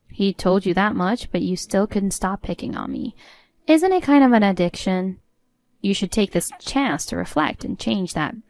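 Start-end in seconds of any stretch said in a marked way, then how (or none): none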